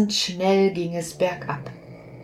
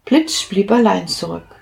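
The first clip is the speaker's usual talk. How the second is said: plain reading voice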